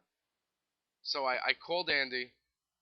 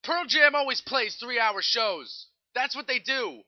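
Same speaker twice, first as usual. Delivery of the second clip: louder, higher and slow, fed up with obvious news; exasperated; 7 semitones higher, 7 dB louder, slower than usual. Real, not acted. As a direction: spell out the obvious, annoyed. Exasperated rant about obvious news.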